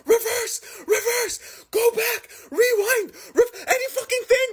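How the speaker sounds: very high, desperate cries; frantic high-pitched pleading; panicked screaming. Retold or imitated. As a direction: beg the car to back off. Begging driver to reverse.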